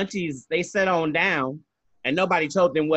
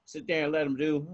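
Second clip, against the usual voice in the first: ordinary talk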